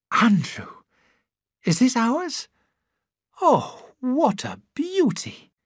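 high, breathless delight at the new car; thrilled young wife. A narrator's reading. Christine voiced delighted.

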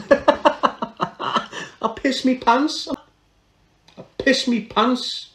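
high-pitched, laughing through the words; helpless high laughter in the voice. Real, not acted. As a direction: crack up while admitting it. Cracking up at own confession.

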